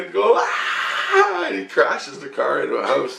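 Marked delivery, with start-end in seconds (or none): 0.4-1.4 freaked-out cry. Retold or imitated. Driver freaking out in a burning car, mock scream. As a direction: imitate a panicked "ah". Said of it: "he's freaking out".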